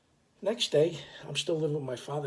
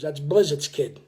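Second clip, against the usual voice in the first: loud reveal; 12 dB louder than usual. Retold or imitated. Fatty reveals the kid's father.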